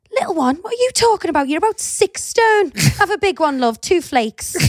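high, incredulous cheeky banter; mock outrage. Ice cream man to mum ordering small, high mock outrage. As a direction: imitate a vendor scoffing in exaggerated disbelief.

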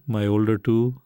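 ordinary delivery

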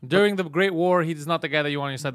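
usual speaking voice

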